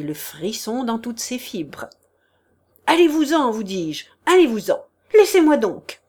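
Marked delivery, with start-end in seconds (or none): 2.9-6.0 louder, higher, shaken command; exasperated shouting. Acted. Prince tries to free his feet, shouting him off. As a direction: push him away with your voice.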